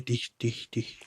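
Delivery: soft imitated tapping on glass, dish dish dish; tapping noise. Dad describing tapping on the window, soft imitated taps. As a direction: mouth a quiet tapping sound. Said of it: "like, going".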